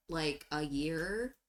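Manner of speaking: slow, low, soft and bored. Acted. Changeling says how long she has worked here, slow bored drawl. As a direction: drag it out without interest. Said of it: "looking like she's so bored of this".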